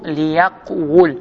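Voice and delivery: ليقول very slowly as li-yaq-wul, split after yaq; underlying form. Shown as a demonstration. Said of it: "والأصل".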